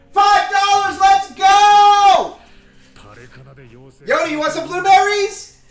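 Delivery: very high, loud happy yelling; full delighted hollering; excited shout. Real, not acted. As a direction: cheer it out to the dogs. Celebrating donation, calling dogs.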